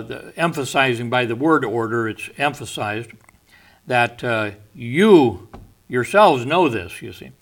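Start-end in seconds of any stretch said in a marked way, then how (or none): 4.7-7.0 the "you" alone punched loud, then "yourselves" weighted; emphatic yourselves. Shown as a demonstration. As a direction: stress on "you" and "yourselves", both pushed louder. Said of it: "yourselves here is emphatic".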